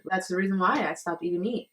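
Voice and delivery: ordinary talk, nothing marked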